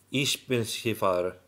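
"Ishbinshifar" said slowly as one run-together word, a clumsy try at German "Ich bin Skifahrer"; halting German attempt. Shown as a demonstration. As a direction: sound out "Ishbinshifar" carefully, unsure of the German. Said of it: "Jag vet inte hur jag uttalar det".

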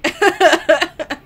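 loud, high laugh; burst of laughter. Real, not acted. Amused at own game commentary.

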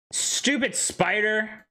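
drawn-out high scorn; stretched-out annoyed jeer; slow disgust. Real, not acted. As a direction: spit the insult slowly. Cursing the spider.

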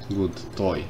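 normal talking voice

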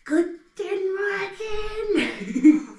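high, very slow, laughing greeting to the teeth; talking to teeth. Pretend. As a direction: coo good morning to the teeth. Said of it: "Seid ihr jetzt wach".

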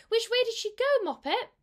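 high, loud urgent asking; mother cat urgently questions her kitten, high and loud. A narrator's reading. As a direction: demand it anxiously.

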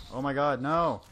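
loud panicked no; yelling. Real, not acted. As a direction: yell it as you die. Dying in game, yelling.

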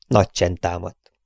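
ordinary delivery, nothing marked

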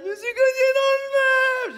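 put-on high, loud, wailing little-boy sob; crying child. Godson crying to his godfather about the furniture, high loud wail. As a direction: whimper it like a hurt toddler.

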